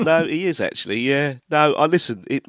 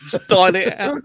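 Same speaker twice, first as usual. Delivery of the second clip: loud teasing echo, higher; mock shout; 8 semitones higher, 7 dB louder, slower than usual. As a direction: shout it back mockingly.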